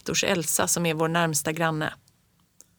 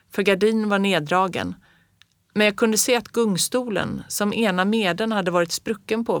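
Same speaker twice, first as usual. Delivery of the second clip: ordinary delivery, nothing marked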